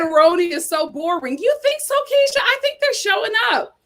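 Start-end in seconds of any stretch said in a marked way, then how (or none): 1.4-3.8 high, teasing pushback; playful challenge. Real, not acted. Answering a commenter who called the show boring, in high playful disbelief. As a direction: tease her right back.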